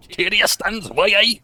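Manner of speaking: put-on Newcastle accent. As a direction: belt it out like a Geordie war narrator. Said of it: "I sound like the guy who did the narration".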